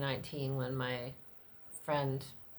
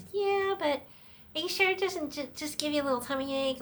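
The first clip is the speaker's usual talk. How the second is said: much higher, thin dismissive mock voice; mocking anesthesiologist; 11 semitones higher, 4 dB louder, faster than usual. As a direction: ridicule her doubt in a high sing-song. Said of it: "the anesthesiologist is like".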